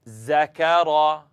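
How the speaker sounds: Zakara slow, z buzzing clearly. Shown as a demonstration. Teacher demonstrates the buzzing z in Zakara. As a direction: stretch the z in Zakara. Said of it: "you hear the difference".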